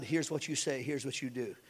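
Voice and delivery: soft, low, confiding; a gentle inner word; God quietly giving instructions. Acted. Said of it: "God said".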